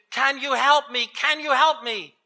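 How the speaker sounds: high-pitched, urgent plea; pleading. A narrator's reading. Tourist pleads to heaven for help, urgently. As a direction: beg for rescue. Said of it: "The tourist pleaded".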